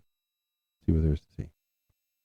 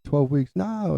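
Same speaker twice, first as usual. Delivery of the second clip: flipping out; 10 semitones higher, slower than usual. Retold or imitated. Mom reacting to the twelve weeks news, flipping out. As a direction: echo it in disbelief, pitch leaping up. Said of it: "flipping out".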